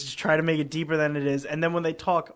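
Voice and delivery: ordinary talk, nothing marked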